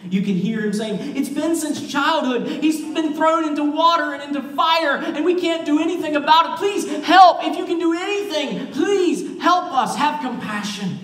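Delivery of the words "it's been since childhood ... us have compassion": high, urgent plea building to a loud cry for help; desperate pleading. Retold or imitated. Father begs Jesus to heal his son, desperate pleading. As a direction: beg at fever pitch as the desperate dad. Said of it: "You can hear the desperation increase in the dad's voice".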